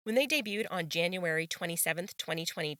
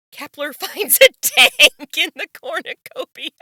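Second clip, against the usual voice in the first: high, loud, laughing announcement; cracking up; 7 semitones higher, 11 dB louder than usual. Real, not acted. Reading an absurd game result aloud, cracking up. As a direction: read it through bursts of laughter.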